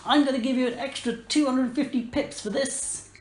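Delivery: high-pitched, excited little creature; amazed kitten mayor. Acted. Kitten mayor thanks the heroes, high amazed gushing. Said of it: "the kitten mayor comes up and goes".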